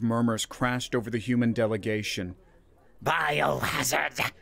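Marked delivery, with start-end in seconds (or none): 3.0-4.3 slow, drawn-out alien alarm cry, slightly higher; chittering insect alien. Acted. Insect alien cries out at the humans in slow alarm. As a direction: stretch the word like a startled insect creature. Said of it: "a Thoraxian chittered".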